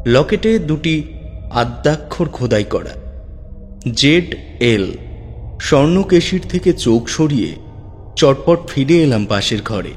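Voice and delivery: usual speaking voice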